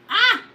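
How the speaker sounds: loud, high "ah"; disgusted yelp. Retold or imitated. Owner recalling how cat stank, disgusted yelp. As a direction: cry out as if hit by a stink. Reacting to the stench.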